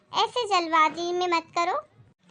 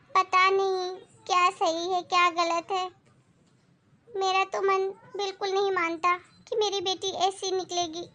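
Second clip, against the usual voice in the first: normal talking voice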